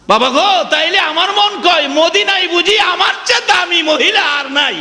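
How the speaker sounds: very high, chanted exclamation; amazed daughter's outcry. Acted. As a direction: cry out to father in overjoyed wonder. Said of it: "মেয়েটা চমকে উঠছে".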